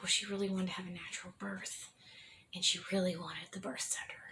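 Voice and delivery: soft whispered plea for his wife; husband whispering. Retold or imitated. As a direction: whisper it gently to the doctor. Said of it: "and he's like".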